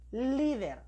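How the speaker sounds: "líder" with a strong, drawn-out first syllable "lí"; stress on LÍ. Shown as a demonstration. Teacher has pupils pronounce the word, stressing LÍ in "líder". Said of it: "su sílaba tónica está en li".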